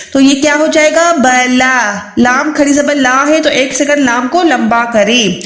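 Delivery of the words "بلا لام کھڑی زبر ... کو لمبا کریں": ordinary delivery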